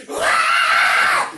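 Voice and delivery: very loud fan shriek; mom's scream. Retold or imitated. Comic imitating his mom screaming at [name], full shriek. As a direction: let out a sudden full scream. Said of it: "my mom is screaming".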